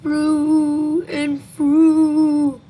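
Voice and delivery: loud, slow, drawn-out ghostly answer; haunting creature intoning slowly; spooky creature. Acted. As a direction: stretch the words into an eerie wail.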